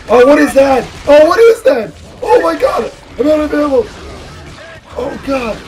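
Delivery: high, loud panicked screams; panicked screaming. Real, not acted. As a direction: shriek in real panic. Monster attack, no ammo.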